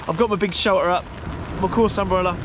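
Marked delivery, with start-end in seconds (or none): none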